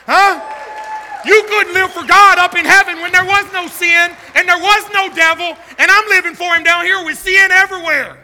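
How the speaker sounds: loud, driving, high-energy proclamation; full-force preaching. Real, not acted. Preacher reminding the devil he lives for God, loudly. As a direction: hurl it at the devil in triumph.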